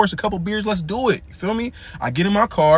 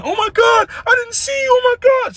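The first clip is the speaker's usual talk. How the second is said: panicking woman. Retold or imitated. The other driver panicking after crashing into him, high crying shout. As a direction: squeal it out like she's terrified and sorry. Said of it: "She started panicking".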